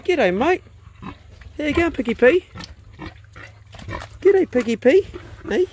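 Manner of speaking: high, cheery voice for greeting a pet; high pet talk. Real, not acted. Greeting his old pet pig at the pen, high cooing pet talk.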